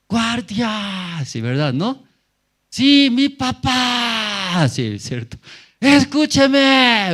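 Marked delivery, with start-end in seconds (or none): 0.1-1.2 high, drawn-out shout for the guard. Shown as a demonstration. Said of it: "¿Cómo grita?". 2.7-4.7 very high, loud, sustained holler; drawn-out shout. Shown as a demonstration. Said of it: "¿Cómo grita?". 5.8-7.1 very high, loud plea to be heard; drawn-out shout. Shown as a demonstration. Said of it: "¿Cómo grita?".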